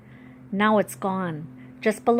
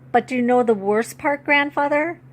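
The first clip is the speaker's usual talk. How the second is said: higher, louder, near tears; voice rising high; 5 semitones higher, 5 dB louder than usual. A narrator's reading. Girl telling great-grandfather the worst part, voice going high. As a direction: let the pitch climb as you break. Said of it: "I am aware how high my voice has become".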